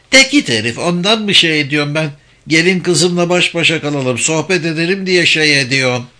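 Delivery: put-on high, indignant old wife; acted old woman's defensive grumble; protesting old mother. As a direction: retort to a teasing husband, pitched high. Mother retorts to teasing husband.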